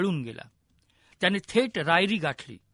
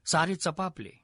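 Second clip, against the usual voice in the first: ordinary delivery, nothing marked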